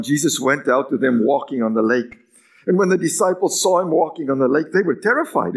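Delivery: ordinary delivery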